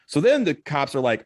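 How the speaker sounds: ordinary delivery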